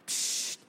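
urgent hushing psst; panicked shush. A narrator's reading. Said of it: "legte panisch einen Finger auf die Lippen".

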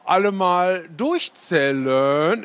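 drawn-out bossy call to count off; Oma Inge calling out. A narrator's reading. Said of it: "rief Oma Inge". (langsamer, lauter Ruf der Oma zum Durchzählen)

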